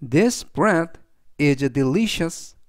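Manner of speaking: very slow, deliberate example sentence; slow model reading. Shown as a demonstration. Teacher reading the example aloud to students, slow and clear. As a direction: read it out slowly and clearly for learners.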